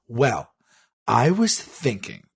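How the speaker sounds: slow, drawn-out deliberation; mock pondering. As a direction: stretch out each word as if musing. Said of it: "I started actually, like, talking like this".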